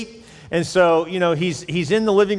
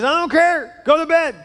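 dad yelling; 12 semitones higher, 5 dB louder than usual. Acted. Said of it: "He says".

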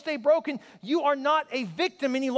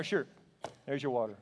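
flat grudging waiter; 8 semitones lower, 6 dB quieter than usual. Retold or imitated.

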